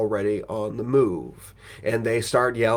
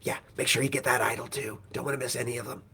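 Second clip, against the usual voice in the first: whisper; 8 dB quieter, faster than usual. Retold or imitated. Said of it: "He's like".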